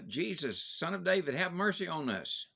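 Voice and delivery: preacher acting the blind men's persistent plea; shouting. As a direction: call out again over the crowd. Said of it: "They kept shouting".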